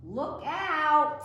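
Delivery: loud, high alarmed shout; a kid crashing mid-cartwheel, shouting a warning; warning yell. Acted. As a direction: yell it as you topple over. Cartwheel going wrong in backyard.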